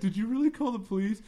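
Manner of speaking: high, loud panicky question; panicky Stu voice. Retold or imitated. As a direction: squeak it out in alarm. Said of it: "He's like".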